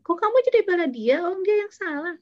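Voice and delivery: hurt that the friend took the other side; offended outburst. Retold or imitated. Upset friend snapping at the one defending her rival, pitched high. Offended reply, acted quarrel.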